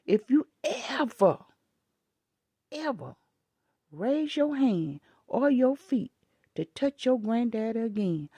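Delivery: retold furious warning to a child in Walmart; angry scolding. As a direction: lay down the law to a misbehaving kid. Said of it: "I said".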